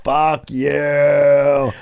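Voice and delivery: mild mock yell, vowels stretched; retold drawn-out taunt. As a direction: drag it out as a parting jeer. Repeats the escape taunt.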